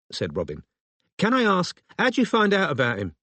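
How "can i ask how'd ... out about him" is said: high-pitched London phone voice; suspicious London woman's enquiry. A narrator's reading. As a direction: question the caller in a pitched-up London voice. London woman questions the caller.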